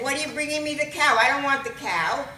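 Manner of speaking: loud, high, fast complaint; loud motherly objection; indignant protest. Acted. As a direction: fire it off at the cop, exasperated. Mother objects to returned cow.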